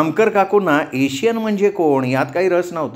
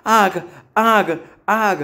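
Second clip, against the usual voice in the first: short, separate cries of alarm; screamed alarm; 6 semitones higher, much slower than usual. A narrator's reading. Roommate running from the kitchen over fire, screaming. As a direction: cry out in bursts, a contained scream. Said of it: "किंचाळत बाहेर आली".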